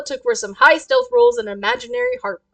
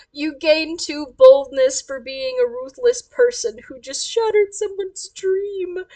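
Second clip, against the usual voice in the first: ordinary talk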